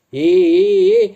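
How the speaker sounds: loud shouted "ए". Acted. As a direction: yell to stop them as you charge in. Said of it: "असं करीत तो एकदम तीरासारखा पुढे घुसला".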